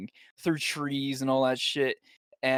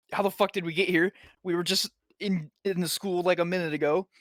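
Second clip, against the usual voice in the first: higher, bewildered retelling; lightly acted confusion, pitched up; mock bewilderment; 4 semitones higher, faster than usual. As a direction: imitate someone baffled by where they landed. Character baffled by sudden teleport.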